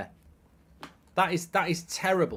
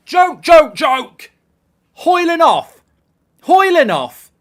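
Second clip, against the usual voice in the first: loud, higher, outraged repeated shouts; furious shouting; 7 semitones higher, 11 dB louder, much slower than usual. Real, not acted. Fan furious as the manager takes Højlund off. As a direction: bark it at the screen in outrage.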